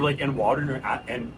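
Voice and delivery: usual speaking voice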